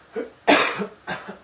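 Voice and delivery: sharp unvoiced cough; loud cough. Real, not acted. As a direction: cough hard away from mic. Coughing after excuse me.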